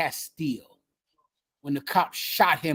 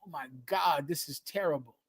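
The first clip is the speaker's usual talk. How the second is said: much softer, hushed horror; hushed dismay; 3 semitones lower, 11 dB quieter than usual. Retold or imitated. Host voicing viewers watching the brutality videos, hushed dismay. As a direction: drop to a quiet, appalled murmur. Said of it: "we all had a feeling of".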